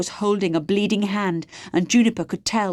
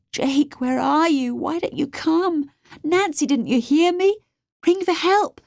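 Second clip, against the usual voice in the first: high, urgent calls for rescue; desperate pleading; 7 semitones higher, 4 dB louder than usual. A narrator's reading. Captive girl begs absent friends and Dad to come, desperate pleading. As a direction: plead with rising desperation, pitch raised.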